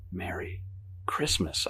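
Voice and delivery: soft, halting holiday greeting; unenthusiastic reply. A narrator's reading. Guest trapped under mistletoe, soft and unenthusiastic. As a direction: say it quietly, with no enthusiasm. Reluctant reply to her shrieking.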